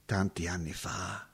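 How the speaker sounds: hushed whisper, lost in memory. Acted. Remembering long ago to the visitor, whispered. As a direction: breathe it out like a secret.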